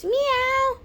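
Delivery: very high imitated meow; cat meow. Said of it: "that cat noise".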